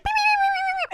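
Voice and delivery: very high loud beeps; alarm beeping. Retold or imitated. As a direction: imitate a shrill alarm.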